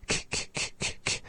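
bare voiceless "k" clicks, repeated; isolated K. Shown as a demonstration. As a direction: release "k" alone with no vowel. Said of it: "that isolated k sound".

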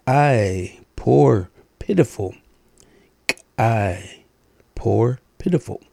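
ordinary talk

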